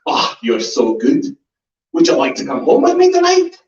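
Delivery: Scots-tinged come-on, rising high; lightly voiced Aberdeen fan flirting; mock Scottish woman. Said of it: "she was like".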